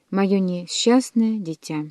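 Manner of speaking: ordinary delivery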